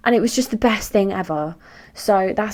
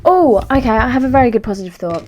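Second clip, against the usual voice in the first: much higher, louder and quicker, sudden delight; bright excitement; 5 semitones higher, 7 dB louder than usual. Real, not acted. Vlogger telling viewers her happy news, excited. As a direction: burst out with a happy idea.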